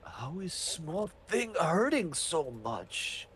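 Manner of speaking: slow, drawn-out monster bewilderment; a slow-witted creature, stretching out each word; puzzled monster. Acted. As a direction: wail it slowly, with big swoops in pitch. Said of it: "And he's like".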